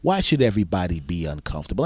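normal talking voice

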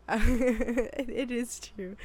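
laughing while conceding the point; amused, catching yourself out; amused self-correction. Real, not acted. Laughing at second-guessing herself.

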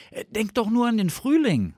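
high, loud rapturous enthusiasm; gushing fox. A narrator's reading. Fox tempts sleepy Bear with spring, gushing. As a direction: gush about it in a high fox voice. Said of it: "sagte Fuchs schwärmerisch".

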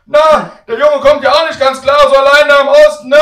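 put-on very high, booming old-lady holler; shouting granny. Grandmother gossiping about grandson, loud high shout. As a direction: shout it without knowing he still hears. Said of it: "schreit Oma".

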